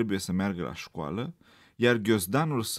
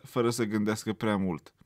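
usual speaking voice

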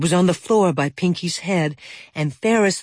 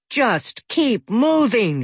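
a slow, drawn-out shout, much higher; 6 semitones higher, slower than usual. A narrator's reading. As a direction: call it out slowly after the departing raccoon. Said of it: "shouted Ferris's mother".

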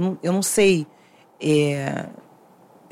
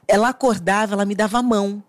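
ordinary delivery

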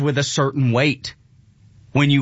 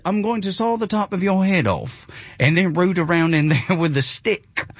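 higher, formal mock-British accent; put-on British doctor's accent; 4 semitones higher than usual. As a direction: imitate a convincing British doctor. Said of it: "but he said it with that".

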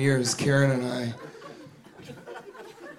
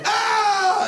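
startled scream. Acted. Girl spots a peeping tom outside her window and shrieks. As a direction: shriek as if caught spied on.

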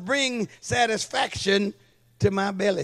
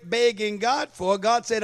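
ordinary talk, nothing marked

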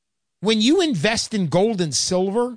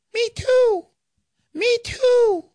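squeaky, much higher little voice; tiny Uncle Sam voice; 4 dB louder, much slower than usual. Retold or imitated. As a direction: pipe up like a tiny man down by the counter. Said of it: "this little voice from down by your shins".

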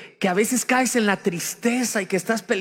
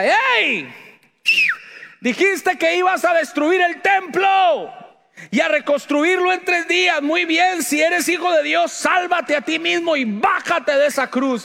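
gritos de burla, fuertes y agudos; 10 semitones higher, 5 dB louder than usual. Retold or imitated. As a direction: grita burlándote del crucificado. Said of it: "le gritaba y le daba insultos". (la gente se burla de Jesús gritando)

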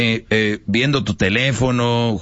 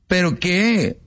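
put-on pushy girlfriend; 7 semitones higher, much slower than usual. Acted woman presses her man.

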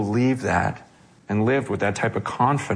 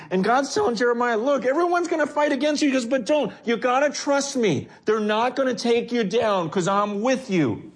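rising fervor; 10 semitones higher than usual. Real, not acted. Preacher builds to high intensity.

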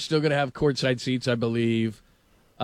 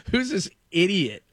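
slow, raised, mock disbelief; retold incredulous scorn; 5 semitones higher than usual. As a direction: imitate a star appalled by a fool. Star pulls hand away, retold.

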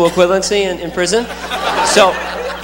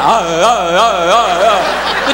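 put-on toddler grunts; much slower than usual. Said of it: "Then just like".

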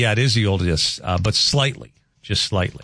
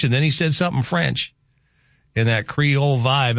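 usual speaking voice

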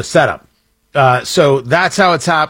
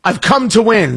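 mock tough guy; 6 semitones higher, slower than usual. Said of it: "He says".

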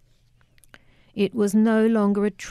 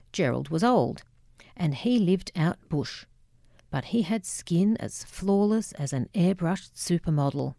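ordinary delivery

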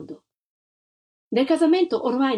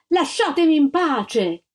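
higher, louder, slow cry; slow shout; 7 semitones higher, 3 dB louder, slower than usual. Acted. Mother shouts at the army from the window, slow and raised. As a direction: drive the tanks back with your voice.

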